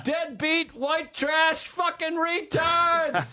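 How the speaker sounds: high shouted insults; high drawn-out stadium yell. Retold or imitated. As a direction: yell it over the roar. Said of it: "And I was like".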